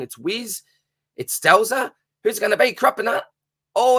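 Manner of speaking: race commentary building to a yell. Acted. Host calling the last heat, building to an excited finish call. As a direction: call the photo finish at full stretch.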